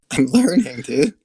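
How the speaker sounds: laughing through the words. Real, not acted. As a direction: giggle out a defence. Laughs while defending himself.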